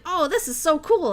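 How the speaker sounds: acting out a happy discovery; light, eager gush; delighted reaction. Retelling own delight at records.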